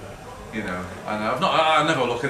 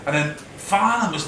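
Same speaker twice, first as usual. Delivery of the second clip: ordinary talk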